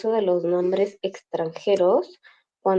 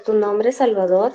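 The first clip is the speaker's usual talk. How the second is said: usual speaking voice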